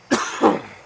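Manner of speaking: coughing on dust. Real, not acted. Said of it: "I got some dust in my throat".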